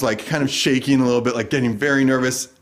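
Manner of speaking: usual speaking voice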